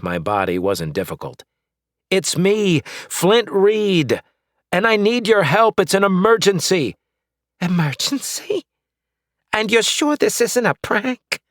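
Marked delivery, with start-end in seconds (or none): 2.1-6.9 angsty plea. A narrator's reading. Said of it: "putting as much angst into my voice". 7.6-11.4 put-on high, suspicious old lady; wary old woman. Old neighbor doubting him, high and wary.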